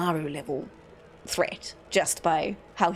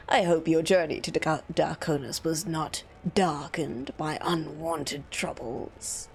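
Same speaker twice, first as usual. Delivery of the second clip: low, slow sinister courtier; lightly menacing, slow formal welcome; sinister bureaucrat; 6 semitones lower, slower than usual. Acted.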